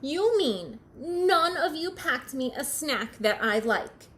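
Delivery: put-upon storybook protest; calling out, indignant. Acted. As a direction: call it out accusingly. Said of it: "Bird called to his friends".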